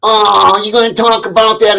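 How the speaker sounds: high, loud whiny mock voice; high, loud mock of the chat hecklers; mocking people. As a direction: mimic the hecklers in a squeaky whine. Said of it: "tired of people fucking".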